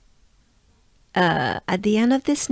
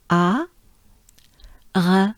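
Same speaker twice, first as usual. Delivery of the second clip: ordinary talk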